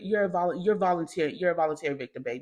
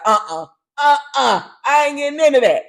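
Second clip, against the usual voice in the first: a dad wanting no part of it; dad backing off; 6 semitones higher, 10 dB louder than usual. Retold or imitated. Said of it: "they be like".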